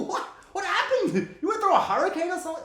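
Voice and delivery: high, loud and fast through laughter; incredulous laughing squeal; laughing disbelief. Retold or imitated. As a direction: gasp it out while cracking up. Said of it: "You're like".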